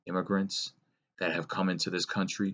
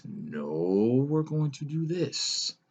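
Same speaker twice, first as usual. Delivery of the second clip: drawn-out mock retort climbing much higher; mock escalating retort; 8 semitones higher, much slower than usual. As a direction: stretch it out, pitch soaring in exaggerated one-upmanship. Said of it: "Democrat comes in again, says".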